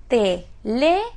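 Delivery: "teléfono" broken into te, le, fo, no; syllable by syllable. Shown as a demonstration. Teacher sounding out an example for students, syllable by syllable. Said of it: "¿Dónde tenemos la acentuación?".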